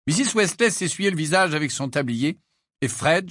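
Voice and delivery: ordinary delivery, nothing marked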